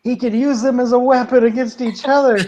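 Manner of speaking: high, amused exclamation, laugh breaking in; excited outburst. Real, not acted. Player delighted at dinosaurs thrown as weapons, giddy outburst. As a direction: blurt it out high and giddy with glee.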